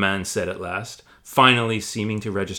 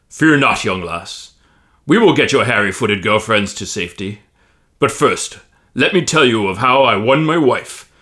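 louder hearty boastful giant; booming Tom; 9 dB louder than usual. Acted. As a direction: reassure her grandly then brag.

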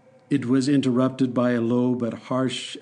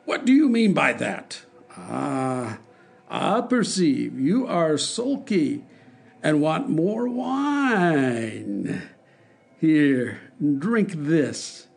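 taunting tyrant; 3 semitones higher, much slower than usual. Acted. The king forces more wine on the dwarf, slow sneer.

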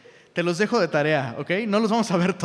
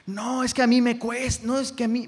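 higher, protesting mock whine; mock whining excuse; 6 semitones higher than usual. Pastor mimicking a member told to ask forgiveness, mock whining. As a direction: imitate someone pleading that it's hard. Mimics someone refusing to apologise.

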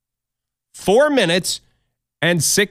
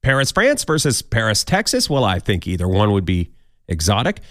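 usual speaking voice

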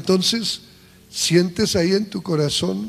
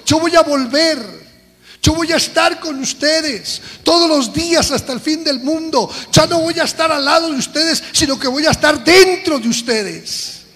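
louder, higher, rising fervor; full-force preaching; 6 semitones higher, 6 dB louder than usual. Acted. Preacher proclaiming Jesus' promise to the church, fervent and loud. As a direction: preach it out to the congregation.

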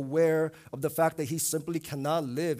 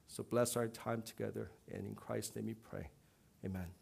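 soft, even prayer voice; 2 semitones lower, 3 dB quieter than usual. Acted. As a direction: pray gently over the congregation. Leads a prayer.

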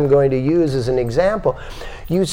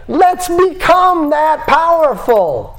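rallying call; 5 dB louder, slower than usual. Real, not acted. Rallying the audience.

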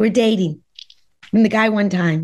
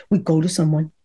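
firm husband; 7 semitones lower than usual. Retold or imitated. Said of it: "He's very strong".

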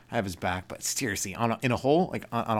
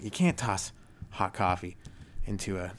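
usual speaking voice

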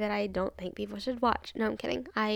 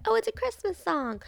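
high, sing-song mock voice; 10 semitones higher than usual. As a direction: parody the people who call it festive. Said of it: "and be like".

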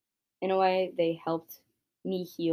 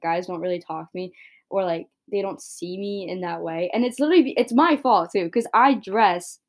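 ordinary delivery

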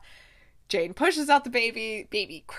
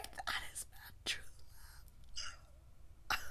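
swooning whisper; 22 dB quieter than usual. Real, not acted. Swooning whisper over romantic scene.

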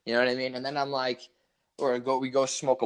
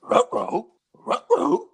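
higher, louder cartoon dog ruh-roh; Scooby-Doo voice; 11 semitones higher, 6 dB louder, much slower than usual. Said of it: "Don't break character".

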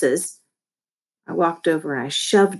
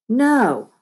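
higher, irritated correction; snarled; 6 semitones higher, 4 dB louder, slower than usual. A narrator's reading. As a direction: snap it out in exasperation. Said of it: "I snarled".

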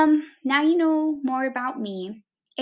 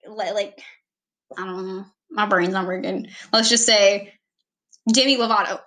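ordinary talk

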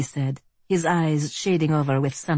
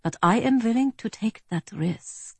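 put-on higher-pitched German accent; refined German villain, pitched up; 8 semitones higher than usual. A narrator's reading. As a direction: calmly defy them in a German accent. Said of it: "in a fine German accent".

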